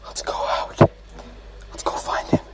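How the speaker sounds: hushed unvoiced whisper. Real, not acted. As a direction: whisper so he can't hear. Sneaking in horror game.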